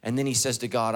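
ordinary talk, nothing marked

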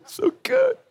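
very high, squeaky weepy voice; mock crying. Dad crying over The Notebook, hammed-up high sobbing. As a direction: wail it in comic, exaggerated sobs. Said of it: "You crying again, Dad?".

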